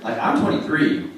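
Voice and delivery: ordinary talk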